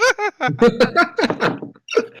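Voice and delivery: high, loud evil cackle; villain cackle. Acted. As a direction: cackle like a gleeful killer. Villain laugh after threat.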